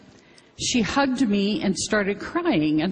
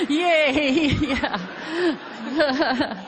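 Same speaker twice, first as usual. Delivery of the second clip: higher, laughing yay; laughing cheer; 6 semitones higher than usual. Real, not acted. Laughing at her own joke.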